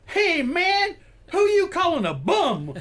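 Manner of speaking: high, loud, slow mock outrage; indignant guy at urinal. Coworker at the urinal snapping back at the candidate, loud high mock outrage. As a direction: mimic the offended man yelling from the urinal. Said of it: "he turned around and goes".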